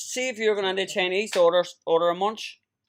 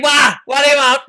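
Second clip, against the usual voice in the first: mock takeaway worker; 7 semitones higher, 10 dB louder than usual. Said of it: "they say".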